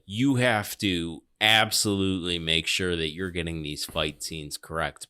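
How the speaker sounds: usual speaking voice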